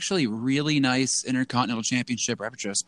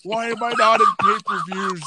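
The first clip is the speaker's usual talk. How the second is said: mocking Big Show; 8 semitones higher than usual. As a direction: complain like an overgrown child, higher. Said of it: "be like".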